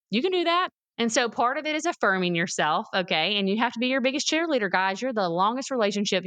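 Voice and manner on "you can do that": high, insistent pep talk to oneself; encouraging self-talk. Acted. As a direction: counter the doubt with a high-pitched lift. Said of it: "I have to tell myself".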